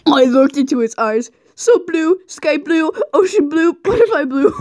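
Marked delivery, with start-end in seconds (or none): 1.5-4.5 mock dreamy. Hams up purple prose.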